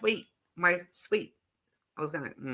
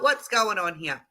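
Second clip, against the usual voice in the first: usual speaking voice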